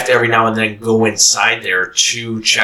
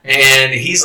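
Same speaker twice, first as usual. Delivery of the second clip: ordinary delivery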